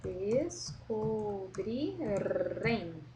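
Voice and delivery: Descobrirem said very slowly, syllable by syllable, R tapped; slow syllable demo. Shown as a demonstration. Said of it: "Olha como é diferente". (Descobrirem bem devagar, sílaba por sílaba)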